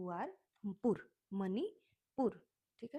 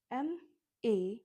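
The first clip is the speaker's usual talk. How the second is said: ordinary talk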